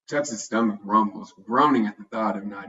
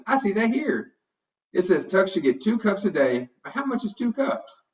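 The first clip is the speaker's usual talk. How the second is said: put-on much higher, eager child's voice; excited kid; 9 semitones higher than usual. A narrator's reading. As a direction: point it out like a thrilled little boy. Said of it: "Huddy excitedly points out".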